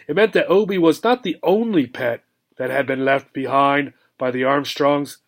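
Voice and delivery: ordinary delivery, nothing marked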